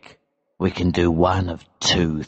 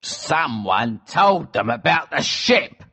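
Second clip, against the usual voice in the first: raised, indignant outburst; angry cry; 5 semitones higher than usual. A narrator's reading. Elsevier realises they were betrayed, angry cry. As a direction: exclaim it angrily, pitch jumping up. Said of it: "cried Elsevier angrily".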